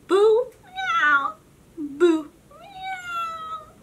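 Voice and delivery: high loud boo and meow; high playful meow cry; spooky cat noise. Acted. Strange sound behind the door.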